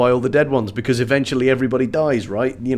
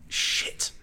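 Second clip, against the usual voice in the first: whispered curse; 9 dB quieter than usual. Pretend. Flubbed line whispered.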